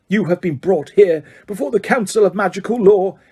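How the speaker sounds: icy official announcing a trial; stern judge, clear. A narrator's reading. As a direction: pronounce the charge crisply, high and hard. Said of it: "he said clearly".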